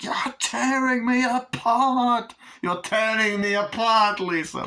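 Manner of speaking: slow, high, loud wail; hammed-up melodramatic despair; mock anguished outcry. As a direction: stretch it into an overwrought cry. Melodramatic acted outcry of torn child.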